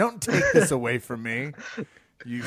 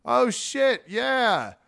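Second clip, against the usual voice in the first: high, startled "oh shit, yeah"; imitating a guy caught off guard, pitch jumping up; surprised recognition; 8 semitones higher, much slower than usual. Telling friends how the champ reacted, with a high surprised yelp. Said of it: "He's like".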